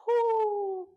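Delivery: whoop. Real, not acted. Energetic whoop after set.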